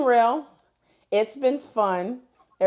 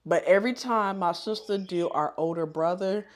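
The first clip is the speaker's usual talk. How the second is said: ordinary talk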